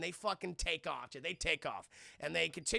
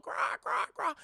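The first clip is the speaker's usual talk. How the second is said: high breathy cawing crows; breathy crow caws; 3 dB louder than usual. Acted. CGI crows popping out of the plane, breathy high caws. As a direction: rasp out the crow calls.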